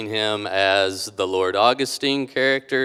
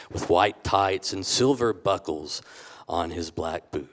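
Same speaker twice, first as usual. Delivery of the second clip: louder dramatic read-aloud narration; story narration; 6 dB louder than usual. Acted. Guest reads his story to the audience, dramatic narration. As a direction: tell the wrestling tale like a storyteller.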